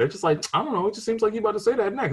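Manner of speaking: high, fast mock dismissal; high fast mocking relative; smug relative. Mimics relative.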